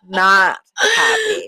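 high, slow, wailing complaint; wailing whine. Shown as a demonstration. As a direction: whine it out like a sulking kid.

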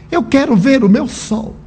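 put-on child insisting. Boy insists to the nurse.

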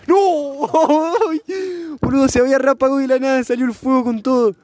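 very high, loud yelp of alarm; startled shrieking. Real, not acted. Friend startled as the fire flares, shrieking.

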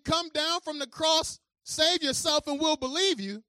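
slow, loud taunt; mocking. Spectators daring Jesus to come down, loud taunt. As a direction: jeer it up at him. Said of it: "they're saying".

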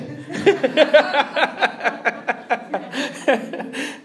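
long rolling belly laugh. Real, not acted. Preacher teasing congregation as weird, long laugh. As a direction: laugh on and on at your own joke. Laughs at his own joke.

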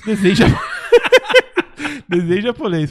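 laughing through the words. Real, not acted. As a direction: break into laughter mid-word. Laughs at friend's joke.

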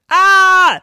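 loud high-pitched scream; daddy's scream. Acted. Daddy sees the jack-o'-lantern, screaming. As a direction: shriek at the shock.

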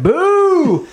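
boo like a whole stadium crowd. Retold or imitated. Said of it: "And everyone was like".

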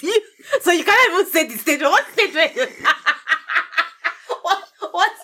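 high, laughing through the words; laughing tease. Real, not acted. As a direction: tease him while giggling. Teasing husband about the statement.

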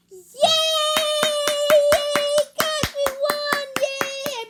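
high, loud shouts of joy; excited cheering. Acted. Narrator cheering Bella's winning home run, high and loud. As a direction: cheer the win like a delirious fan.

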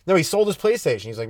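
ordinary talk, nothing marked

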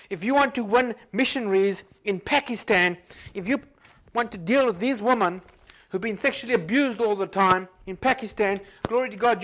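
loud, high preaching push; fiery preaching. Acted. Pastor exhorting future missionaries, loud and fiery.

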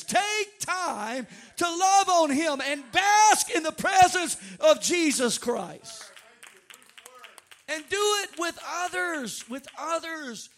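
drawn-out, loud preacher's exhortation; slow, ringing pulpit cry; preaching at full force. Real, not acted. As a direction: preach it high and loud, stretching the words.

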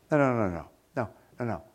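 normal talking voice

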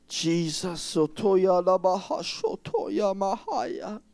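soft slow tongues; speaking in tongues. Real, not acted. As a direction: murmur in tongues. Speaking in tongues during prayer.

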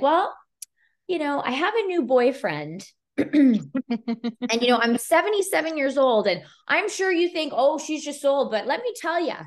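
lightly voiced sassy old woman; older client voice. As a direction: play the spunky seventy-seven-year-old. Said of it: "she's like".

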